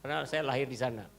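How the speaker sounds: ordinary delivery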